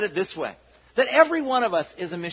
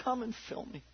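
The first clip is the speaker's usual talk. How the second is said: soft prayer; 3 semitones higher, 13 dB quieter than usual. Acted. Repeated prayer, quiet.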